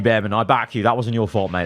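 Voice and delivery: usual speaking voice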